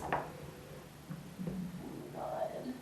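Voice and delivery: hushed startled gasp of words; breathy scared whisper; shocked whisper. Real, not acted. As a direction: whisper it in real alarm. Hears knocks in the dark.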